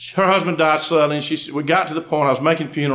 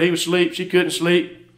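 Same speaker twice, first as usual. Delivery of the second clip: ordinary talk, nothing marked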